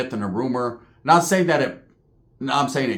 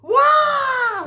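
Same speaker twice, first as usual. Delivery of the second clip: very high, loud drawn-out kung fu shriek; Bruce Lee war cry; 5 dB louder than usual. Retold or imitated. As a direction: mimic a martial arts battle cry. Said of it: "remember when fucking Bruce Lee goes".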